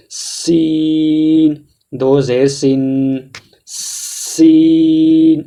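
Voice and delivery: سین held very slow with a whistling hiss; whistling Seen recitation. Shown as a demonstration. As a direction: stretch سین, letting the s whistle. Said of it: "سین کو ادا کرتے وقت سیٹی کی آواز ہوتی ہے". (سین کو سیٹی کی آواز کے ساتھ کھینچ کر)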